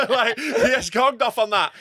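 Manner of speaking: high laughing burst, then slow words through laughter. Real, not acted. Comic laughing recalling the heckler gonging off. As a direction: laugh hard while telling it. Laughing retelling wild gig.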